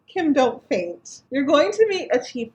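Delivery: high, loud, giddy pep talk to herself; telling herself not to faint, thrilled; excited self-talk. Retold or imitated. Retold excited self-talk on meeting chief.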